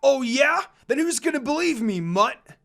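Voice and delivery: loud mocking yell; shouting. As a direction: yell back a challenge. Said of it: "Koga shouted".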